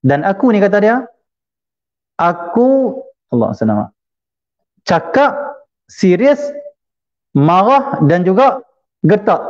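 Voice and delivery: high, drawn-out emphatic words; mock-threatening emphasis; stern emphasis. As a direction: punch each word, spaced by pauses. Preacher stressing the man's harsh manner.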